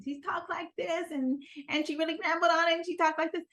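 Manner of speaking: put-on high, small, mousy little-girl voice; little girly mousy voice. Shown as a demonstration. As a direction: squeak it out like a timid little girl. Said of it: "her voice was very little girly, very mousy".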